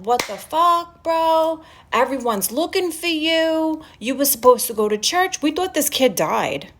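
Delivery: high-pitched, indignant retold telling-off; exasperated scolding. Retelling her call to missing Bob, exasperated. Acted angry phone message to Bob.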